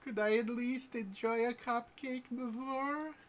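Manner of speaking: put-on high, innocent girlish voice; coy high girl voice; sweet Jester voice. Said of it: "She's like".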